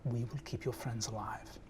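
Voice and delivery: a scheming mother speaking in a breathy whisper; mother's hushed voice. Acted. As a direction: whisper it like a cold, controlling matriarch.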